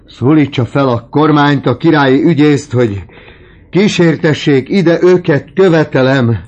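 very high, loud, shrill demanding cries; old man shrieking his demands; frantic demand. Acted. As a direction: scream the demands at the top of his voice. Dying father demanding daughters.

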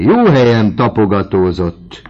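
loud, high teasing cry; calling out. A narrator's reading. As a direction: cry it out across the table. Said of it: "kiáltott Vauquéné".

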